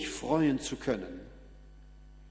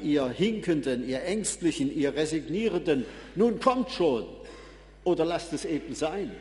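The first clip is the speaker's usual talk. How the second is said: impatient giving up. Acted. Preacher acting himself giving up on the limping and fearful, exasperated. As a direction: lose patience with stragglers, then give up on them. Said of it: "Ich hätte schnell aufgegeben. Ich hätte gesagt".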